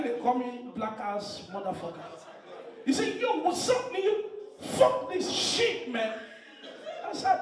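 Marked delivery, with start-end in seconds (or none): 3.2-6.2 high-pitched mock American girl; hammed-up high American voice. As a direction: imitate her brash demands in a raised pitch. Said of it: "I know American girls, their ringtone is different".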